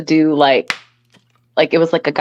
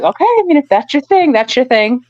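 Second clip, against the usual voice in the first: high-pitched, hesitant going along with it; lilting, mock-accommodating reply; retold tentative acceptance; 8 semitones higher than usual. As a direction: quote yourself humoring an odd request. Retelling her hesitant acceptance.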